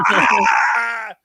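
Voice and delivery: high mock laughing yell; imitated laughing cry. Coworker finding out about the prank, imitated laughing yell. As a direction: let out his laugh as a yell. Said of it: "And he starts laughing".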